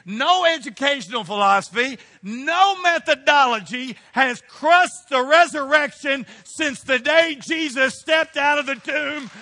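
loud, rising shouted proclamation, slowing at the peak; preacher declaring the gospel unstoppable; full-force preaching. Acted. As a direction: proclaim it over the crowd like a battle cry.

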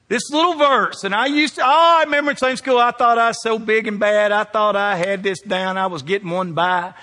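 high and loud, fast then slowing; excited preaching. Acted. Pastor telling the church about Sunday school, excited preaching.

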